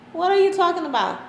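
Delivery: high, loud incredulous question; retold disbelief. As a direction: throw it back in disbelief, pitched high. Mother's shocked reply retold.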